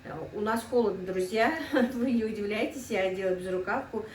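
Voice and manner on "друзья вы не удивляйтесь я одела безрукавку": usual speaking voice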